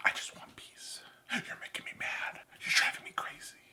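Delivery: mock argument in a whisper; hushed, whispered bickering; without raising voice. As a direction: whisper the complaints, barely any sound. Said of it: "That's me without raising my voice".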